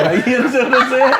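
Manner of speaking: high, laughing chatter. Real, not acted. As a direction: talk through giggles. Both laughing about the DJ story.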